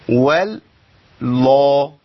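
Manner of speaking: والله dragged out very slowly, one lam heavy; imitated mistake. Teacher imitating a student who thickens only one lam. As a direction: mimic the half-heavy lam error. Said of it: "فيقول إيه".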